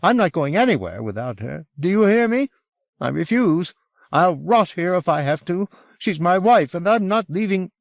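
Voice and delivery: high-pitched, defiant protest; hardened assertion. A narrator's reading. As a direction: insist high and agitated, refusing to budge. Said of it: "His pleas hardened into assertions".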